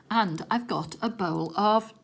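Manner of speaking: ordinary delivery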